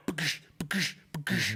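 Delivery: mouthed thuds of a hammer; hammer thud noises. Retold or imitated. Retelling the hammer murder with mouthed thud noises. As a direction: imitate hammer blows with your voice. Hammer blow sound effect.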